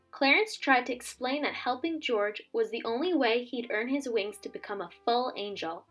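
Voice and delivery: plain reading voice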